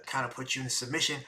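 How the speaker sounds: ordinary talk, nothing marked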